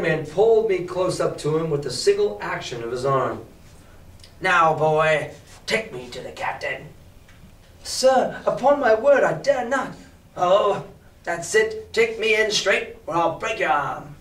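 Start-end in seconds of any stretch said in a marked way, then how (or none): 4.4-6.9 soft menacing beggar. Acted. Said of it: "soft-spoken". 7.8-9.9 put-on frightened boy. 10.4-14.1 menacing beggar. Acted. Beggar threatens boy.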